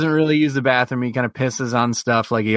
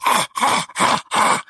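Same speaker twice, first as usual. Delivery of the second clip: harsh voiceless rasping gasps; imitated choking dog; 10 semitones higher than usual. Owner mimics dog choking on walk, rasping gasps. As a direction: wheeze like a dog straining on its leash. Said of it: "you hear him going".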